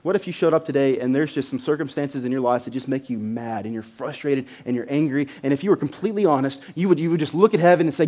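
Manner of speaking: ordinary talk, nothing marked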